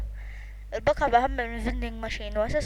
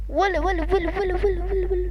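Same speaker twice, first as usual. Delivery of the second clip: calling out; 7 semitones higher than usual. Real, not acted. Calling out again, higher.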